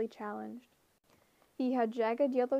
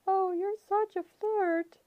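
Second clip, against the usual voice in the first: high, slow, coy falsetto; 9 semitones higher, 5 dB louder than usual. A narrator's reading. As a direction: coyly tease in falsetto. Grover's falsetto.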